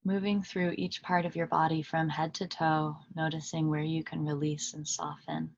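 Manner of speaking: calm meditation guide with long pauses between phrases; unhurried, even voice settling listeners into stillness; guided meditation. Acted. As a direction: lead them gently inward, leaving long silences.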